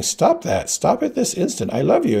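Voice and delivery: high, urgent motherly command; frantic mother, high voice without shouting; desperate scolding. A narrator's reading. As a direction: plead and scold, pitched right up. Desperate mother scolds struggling child.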